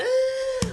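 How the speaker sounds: sharp high gasp of amazement; awed gasp. Retold or imitated. Overwhelmed by bliss after the levitation, high gasp. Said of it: "I was just like".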